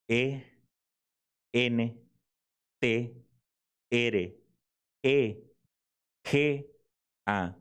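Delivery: each letter alone, with a pause after every one; spelled out letter by letter. Shown as a demonstration. Pastor spells entrega for the congregation, one letter at a time. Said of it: "Se deletrea de la siguiente manera".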